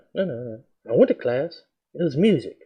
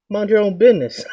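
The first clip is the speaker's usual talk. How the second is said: higher, cocky talk-back to the teacher; teen sassing his teacher, higher and cocky; sassy retort; 8 semitones higher, 3 dB louder than usual. Retold or imitated. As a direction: mouth off at him, cocky and high.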